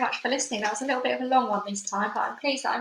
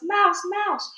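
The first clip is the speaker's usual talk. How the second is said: shouting alarm; 10 semitones higher, 5 dB louder than usual. Acted.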